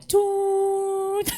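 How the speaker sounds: long high steady beep; imitated toot. Preacher mimics the argument going silent, long comic toot. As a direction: hold a comic high tone, drawn out.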